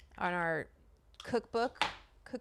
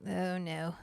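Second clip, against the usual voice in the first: normal talking voice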